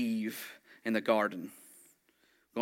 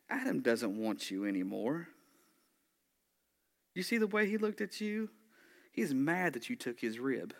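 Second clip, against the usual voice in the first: lightly voiced serpent, softer, pitch jumping up mid-taunt; serpent planting doubt; 2 semitones higher, 5 dB quieter than usual. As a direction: play a hint of an insinuating tempter. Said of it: "Like he could have said".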